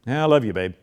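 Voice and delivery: toneless, dutiful I love you babe; flat, bored. Shown as a demonstration. Preacher mocks a husband's passionless I love you. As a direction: mumble it without feeling. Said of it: "don't tell your spouse you love her by saying".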